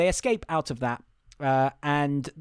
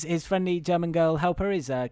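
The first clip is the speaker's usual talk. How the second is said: normal talking voice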